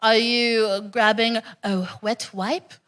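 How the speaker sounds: usual speaking voice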